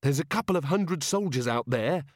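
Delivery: snapped. A narrator's reading. Angua warning of soldiers outside, mildly snappish. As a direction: cut in with a touch of impatience. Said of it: "Angua snapped".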